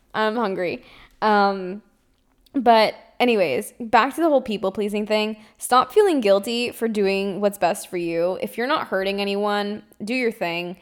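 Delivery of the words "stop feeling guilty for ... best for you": ordinary talk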